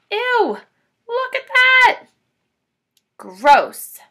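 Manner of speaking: disgusted. Real, not acted. Reader reacts to picture.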